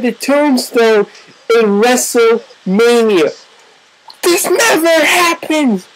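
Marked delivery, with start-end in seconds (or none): 4.2-5.8 high, drawn-out commentator's cry; high-pitched astonished call; stunned excitement. Real, not acted. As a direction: stretch it out in amazed disbelief. Stunned by the kick-out.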